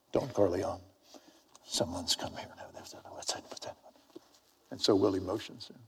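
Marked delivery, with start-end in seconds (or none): 0.1-2.5 hushed, lower murmur to a boss; soft, confidential announcement; mock deferential whisper. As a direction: lean in and whisper discreetly. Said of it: "she whispered".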